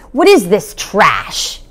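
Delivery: disgusted father demanding; contemptuous father. A narrator's reading. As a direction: sneer at the book. Father snatches the book.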